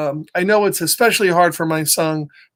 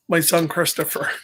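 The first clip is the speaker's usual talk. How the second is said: plain reading voice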